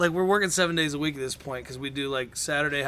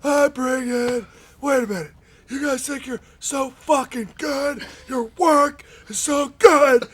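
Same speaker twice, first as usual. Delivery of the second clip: slow, higher, loud angry boss rant; furious rant; 8 semitones higher, 5 dB louder, slower than usual. Retold or imitated. Boss berating wrestlers over the unread book, slow furious rant. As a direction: rage at them like a boss who feels disrespected. Johnny ranting in his office.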